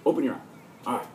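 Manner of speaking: ordinary talk, nothing marked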